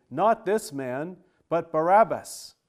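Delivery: loud crowd shout, Barabbas loudest; crowd crying out. Acted. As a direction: bellow it back as an angry mob. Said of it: "Then they all cried out again".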